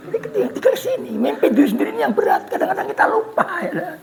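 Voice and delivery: high, laughing through the words. Real, not acted. Preacher joking to audience, laughing high. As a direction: let the laugh break the line. Laughing over leadership joke.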